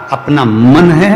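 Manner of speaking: अपना मन है said slow and loud as the key answer; emphatic slow punch. Real, not acted. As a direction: land the answer hard, slowly. Preacher drives home the point.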